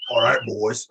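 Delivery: low mimicked reply; dropped accent. Co-host imitating the wrestler's real voice, low. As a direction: drop well down in pitch, imitating his real voice. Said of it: "he dropped his accent to respond".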